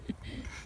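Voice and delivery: quiet breathy chuckle to oneself; soft laugh. Real, not acted. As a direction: laugh very softly at your own slip. Laughs at own mistake.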